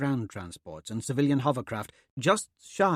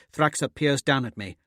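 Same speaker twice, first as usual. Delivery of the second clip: ordinary delivery, nothing marked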